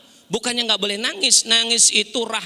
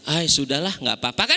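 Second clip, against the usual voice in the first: lower, easy shrug of a voice; a touch of a relaxed housewife; calm letting go; 7 semitones lower than usual. Acted. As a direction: let it go lightly, like a calm mother. Mother shrugging off lost money.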